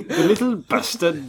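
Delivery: slow, high, loud Scottish put-on; hammed-up Scottish accent; mock Scottish accent. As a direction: growl the line like a Scottish driver. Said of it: "I didn't do a Scottish accent".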